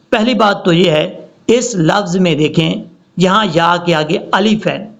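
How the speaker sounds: ordinary talk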